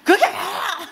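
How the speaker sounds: high, loud mock astonishment; comic startled outburst. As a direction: yelp back, taken aback. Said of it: "그래 놀래가지고".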